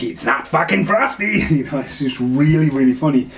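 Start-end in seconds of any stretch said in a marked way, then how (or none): 0.0-1.4 high, foreign-sounding snarky snowman voice; Jack Frost voice. Retold or imitated. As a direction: sneer it like a wisecracking killer snowman.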